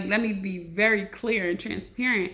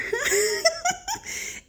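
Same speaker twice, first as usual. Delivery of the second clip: a squealing burst of laughter, pitched way up; high excited laugh. Real, not acted. Showing her followers what she found, squealing laugh. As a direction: let a delighted high laugh escape.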